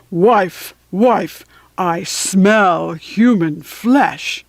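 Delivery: slow, booming giant voice; giant's cry. A narrator's reading. Giant calling to his wife about the smell. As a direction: draw out the giant's words, heavy and slow. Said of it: "he cried".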